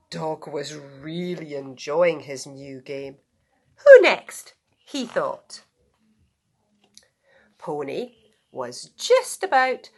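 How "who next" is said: high loud eager squeal; gleeful. Acted. Dog eager to find the next victim, gleeful. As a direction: pop it out with mischief.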